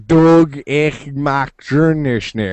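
slow, mock German-sounding "Dog ich mag Journeyschnee"; a hint of a German accent, slowly; mock garbled pronunciation. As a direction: mangle it like fake German. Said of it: "it was literally like".

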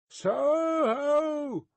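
high, sustained holler; long high shout; roaring call. A narrator's reading. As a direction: sing it out loud across the distance. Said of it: "as loud as he could roar".

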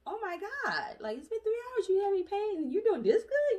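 high, rising astonished voice; amazed staff. Retold or imitated. Staff amazed at patient's calm after three hours, high and astonished. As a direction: sound shocked she is so calm. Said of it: "they were like".

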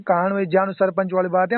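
usual speaking voice

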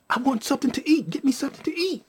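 high, insistent little demand; demanding mother. Retold or imitated. Baby mother suddenly wants food, high and insistent. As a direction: imitate her asking, pitched well up.

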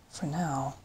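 hushed aside to oneself; inward thought. A narrator's reading. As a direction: think it softly, privately. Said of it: "he thought".

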